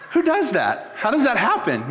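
amused disbelief through laughter; laughing disbelief. Real, not acted. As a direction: laugh at how absurd it is. Laughs at the absurd story.

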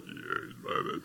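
imitating his exhausted self, very soft and voiceless; worn-out voice. Tired husband on the phone after moving day, imitated worn-out voice. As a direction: barely get it out, as if spent. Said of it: "I could even hear it in my voice".

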